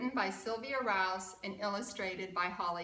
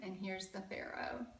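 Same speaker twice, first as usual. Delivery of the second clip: whisper; 6 dB quieter than usual. Real, not acted. Whispered aside about picture.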